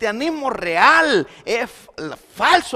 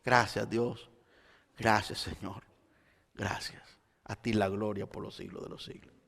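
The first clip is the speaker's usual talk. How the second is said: soft thankful prayer; 13 semitones lower, 15 dB quieter than usual. Shown as a demonstration. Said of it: "anda dando gracias a Dios por todo".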